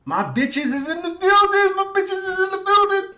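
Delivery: very high, sustained playful squeal; squealed hype call. Pretend. Streamer playfully hailing his viewers, high squealed shout. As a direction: climb into a high squeal and stay there.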